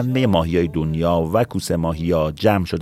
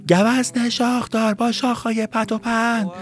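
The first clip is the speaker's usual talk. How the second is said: calling out; slower than usual. Acted. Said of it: "داد زدن".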